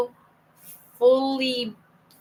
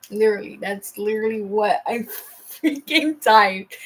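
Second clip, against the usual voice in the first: laughing; 4 dB louder than usual. Real, not acted. Reader amused by own text to mom, laughing. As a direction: talk breaking into a real laugh.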